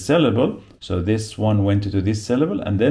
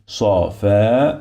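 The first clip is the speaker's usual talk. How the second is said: syllable by syllable. Shown as a demonstration. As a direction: break "صفاء" into separate syllables, final u apart. Teacher sounds out word by syllables.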